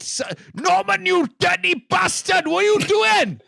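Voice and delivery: very high, comic outraged outburst; outraged scolding. Acted. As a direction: break off and scold at a high pitch. Breaks off reading to scold Norman.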